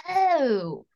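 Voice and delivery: high, startled realization; teen self hit by a worrying thought, pitched high; sudden realization. Retold or imitated. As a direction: catch yourself realizing something big. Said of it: "And I just was like".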